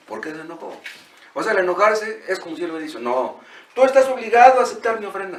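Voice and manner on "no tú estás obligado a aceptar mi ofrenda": louder, insisting God must accept; indignant demand. Acted. Preacher voicing Cain demanding God accept his offering, indignant. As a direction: voice Cain's entitled protest, pushing hard.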